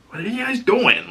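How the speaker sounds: high and sneering; lightly voiced jerk from the game; mocking Seth. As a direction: needle them like a smug jerk. Seth calling out the kiss.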